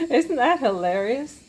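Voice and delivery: amused laugh, then the question; laughing delightedly at a silly sound. Real, not acted. Laughing at the waffle iron noise.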